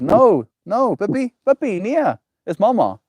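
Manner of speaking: high, loud call to the dog to hush it; calling the barking dog off, loud and high. Real, not acted.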